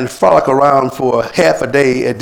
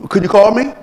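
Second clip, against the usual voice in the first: higher-pitched mock complaint; acted reproach; 8 semitones higher, 4 dB louder than usual. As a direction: challenge the absentee, pitched up. Acted reproach in same quarrel.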